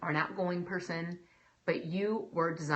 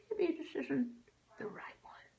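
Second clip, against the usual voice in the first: hushed second thought; 7 semitones higher, 7 dB quieter than usual. Acted.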